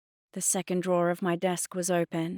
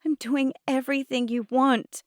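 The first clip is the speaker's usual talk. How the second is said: high-pitched hurt protest; near tears; 8 semitones higher, 4 dB louder than usual. A narrator's reading. Girl defending herself to Mum, near tears. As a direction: plead your case, nearly crying. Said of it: "I was on the verge of tears".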